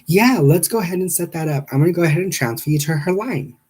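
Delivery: high, chipper receptionist; sing-song customer service voice. Retold or imitated. Receptionist voiced in phone story.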